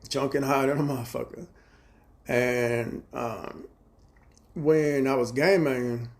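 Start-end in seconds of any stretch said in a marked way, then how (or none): none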